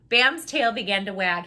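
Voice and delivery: ordinary delivery, nothing marked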